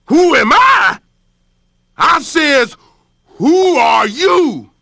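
loud, slow, furious roar; lion enraged at being talked back to, roaring; angry roar. Acted. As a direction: roar it down the well. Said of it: "roared back down".

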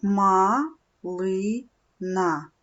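Ма, ли, на said syllable by syllable with pauses; split into syllables. Shown as a demonstration. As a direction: break малина into its three syllables. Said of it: "три склади".

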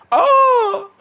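sudden high yelp of surprise; surprised shout. Real, not acted. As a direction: blurt it out, shocked and loud. Sudden big play reaction.

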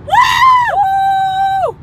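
high, loud whoop; excited woo. Real, not acted. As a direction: whoop with joy.

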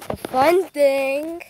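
ordinary talk

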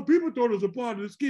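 usual speaking voice

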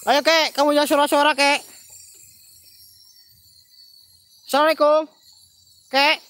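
loud, high summons; calling out. Real, not acted. Host urges the old ghost to stop hiding, calling loudly. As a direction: shout out to someone hidden.

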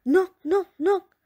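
usual speaking voice

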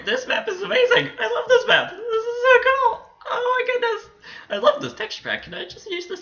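very high, giddy fan voice; gushing squeal. Real, not acted. Gamer gushing over map and texture pack, gushing squeal. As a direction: squeal with delight all through.

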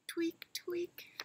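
high whispered trunk tweaks; squeaky baby elephant. Acted. Trunk signal.

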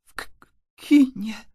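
put-on stuttering scared boy; frightened stammer. A narrator's reading. Antoś answers the vampire about his parents, stammering. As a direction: trip on the first sound in fright. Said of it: "wyjąkał Antoś".

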